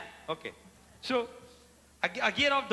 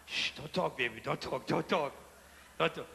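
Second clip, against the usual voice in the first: soft, lower hushing; 6 semitones lower, 6 dB quieter than usual. Retold or imitated. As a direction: shush her gently. Hushing the girlfriend.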